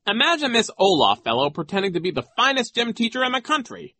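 very high, mocking amazement; pompous joking at a silly idea; Nero. A narrator's reading. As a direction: scoff in a high, put-on voice. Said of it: "making idiotic jokes".

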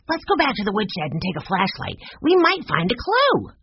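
put-on high child's voice rising with excitement; eager young Benny. A narrator's reading. Benny urging a trip back to the woodshed, eager. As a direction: pitch the plan eagerly, climbing high.

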